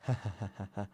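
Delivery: low, soft villain chuckle; quiet sinister ha-ha-ha; mock evil laugh. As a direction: chuckle menacingly, playing the killer. Killer's laugh before the threat.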